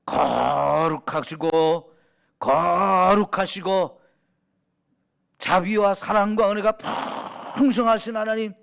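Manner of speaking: mock solemn prayer with long drawn-out first syllables; stilted formal prayer. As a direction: parody a pompous public prayer. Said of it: "기도하라고 하면".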